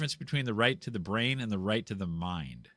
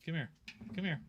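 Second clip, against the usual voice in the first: short, higher-pitched calls to a pet; beckoning a pet; coaxing the dog; 4 semitones higher, 6 dB quieter, much faster than usual. Real, not acted. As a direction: call your dog over gently, voice lifted. Calling his dog to him.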